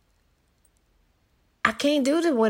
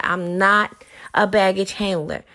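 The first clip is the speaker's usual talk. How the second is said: ordinary delivery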